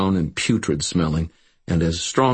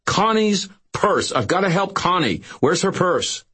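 frantic shouting; 13 semitones higher than usual. A narrator's reading. Frantic search for missing girl.

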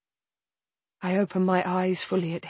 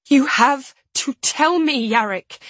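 louder, higher desperate plea; begging; 6 semitones higher, 5 dB louder than usual. A narrator's reading. Narrator shaking Yarrick by the shoulders, begging. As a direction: beg him to answer you. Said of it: "begging him to answer me".

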